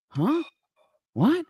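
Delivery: high, squeaky double take of disbelief; a jolted, incredulous what?; stunned disbelief. Retold or imitated. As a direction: caught completely off guard, voice leaping way up. Stunned reaction to the Fort Worth news.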